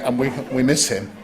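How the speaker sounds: ordinary talk, nothing marked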